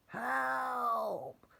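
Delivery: high, falling cry for help; witch crying for help. Acted. Witch fleeing the dragon, high panicked cry. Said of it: "cried the witch".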